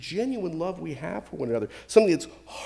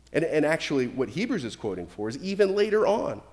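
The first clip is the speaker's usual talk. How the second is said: ordinary talk, nothing marked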